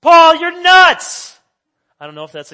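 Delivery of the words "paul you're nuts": huge, slow, high shout. Retold or imitated. Festus shouting at Paul, mock outrage. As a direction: bellow it across the courtroom. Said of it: "he just shouts it".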